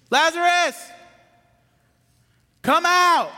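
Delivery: loud, high command to come out; commanding call. Acted. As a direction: call him out of the grave with full voice. Jesus calls Lazarus from tomb.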